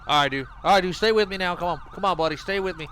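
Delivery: high, loud urgent pleading; man down shout. Acted. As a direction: keep the dying man conscious. Wounded man down.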